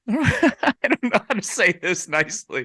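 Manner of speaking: high, laughing hesitation; awkward laughing. Real, not acted. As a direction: laugh your way into a delicate remark. Laughing while searching for tactful words.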